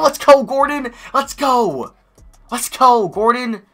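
high, loud repeated cheer; excited cheer. Real, not acted. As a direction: celebrate the shot at full voice. Cheering a made shot.

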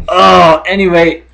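usual speaking voice

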